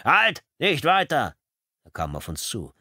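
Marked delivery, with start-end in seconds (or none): 0.0-1.3 loud, high shouted stop command; police officer snapping at reporters to stop; barked order. A narrator's reading. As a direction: bark it like a cop halting intruders. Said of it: "schnauzte uns ein uniformierter Polizist an".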